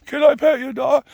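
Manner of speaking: mock squeal, high-pitched and loud; hammed-up excited dog lover; gushing dog weirdo. Dog weirdos begging to pet someone's dog, high mocking gush. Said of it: "All those dog weirdos".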